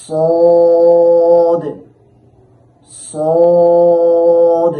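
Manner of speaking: loud sung Sad, held steady; Arabic letter demo. Shown as a demonstration. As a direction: chant the letter out loud like Quran recitation. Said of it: "জিহ্বার আগা ... লাগাইয়া উচ্চারণ করতে হবে". (জোরে টেনে সদ উচ্চারণ)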